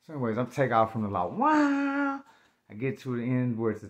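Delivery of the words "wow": high, held-out "waaah" of a bike taking off; long high zooming noise; engine roar imitation. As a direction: imitate a motorcycle screaming away.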